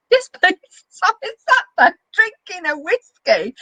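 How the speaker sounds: breaking into high laughter mid-sentence; laughing at her own story. Real, not acted. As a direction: giggle through the words, hard to keep it together. Laughing at the memory.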